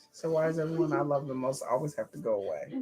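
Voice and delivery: low, soft, sad; mournful soft question; sad character line. Retold or imitated. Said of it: "Oh, that's sad".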